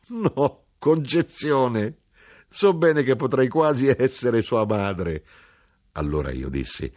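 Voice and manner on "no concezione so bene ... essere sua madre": high, loud, drawn-out protest; esclamazione. A narrator's reading. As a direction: exclaim slowly in a much higher, louder voice. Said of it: "rise contro il cuscino ed esclamò". (esclamazione acuta, forte e strascicata)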